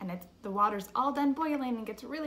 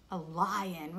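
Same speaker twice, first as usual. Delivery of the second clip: ordinary talk, nothing marked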